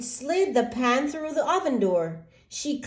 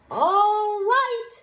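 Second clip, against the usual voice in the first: high, louder all right; resigned hen; 12 semitones higher, 3 dB louder than usual. Acted. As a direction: accept it and get to work. Hen accepts alone.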